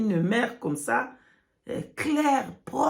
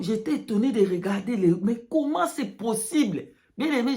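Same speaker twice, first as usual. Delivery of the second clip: usual speaking voice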